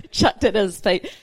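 slow, laughing through the words. Real, not acted. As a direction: say it while laughing at yourself. Laughing at her own joke.

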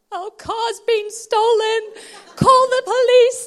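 high, very loud frantic cry; hammed-up panic. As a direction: wail it down the phone in mock alarm. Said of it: "but panic".

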